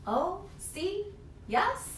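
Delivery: a hint of a simple helper saying yes; lightly voiced Big Anthony. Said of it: "said Big Anthony".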